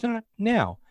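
high-pitched imitated insistence; retold priest insisting. Priest demanding proof from the possessed girl, voiced high and insistent.

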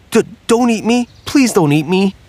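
high, stammering turkey begging; a frightened turkey pleading for its life. Acted. Turkey begs not to be eaten.

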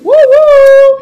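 high, loud woo; excited cheer. Real, not acted. Cheer for friend's apartment.

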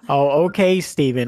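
sarcastic "okay" to the call agent. Retold or imitated. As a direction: drip sarcasm on the fake name. Sarcastic reply to fake name.

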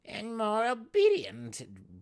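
loud, high, slow mockery; a sly jab; mocking quip. A narrator's reading. Brisa mocks the wraith for the females, loud.

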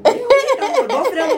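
high laughing insist. Real, not acted. Laughing about no more kids.

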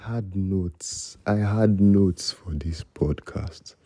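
drawn out, each word slow; slow admission. Real, not acted. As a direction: spell it out slowly like a confession. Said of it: "slow emphatic admission".

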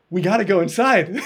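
giddy dare to break in; excited urging. Retold or imitated. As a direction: push them to go in, half laughing. Dad urging at Graceland door.

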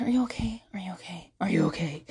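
softly imitated worried teen; fading, timid check-ins; mock hushed concern. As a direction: drop to a near whisper, checking repeatedly. Mimics anxious teenage boy, hushed.

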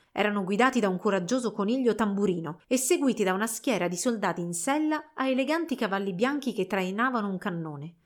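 plain reading voice

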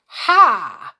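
loud triumphant ha; pixie delight. Acted. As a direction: crow with glee like a pixie. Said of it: "said the pixie in delight".

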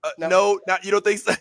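ordinary talk

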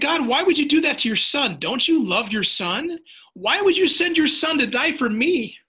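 high, loud pleading protest, voiced for his son; upset child's protest. Eight-year-old son protests to God in the car, high and loud. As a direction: cry out like an upset boy asking why. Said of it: "He got mad at God".

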